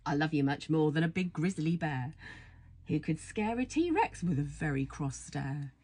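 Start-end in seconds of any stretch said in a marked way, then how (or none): none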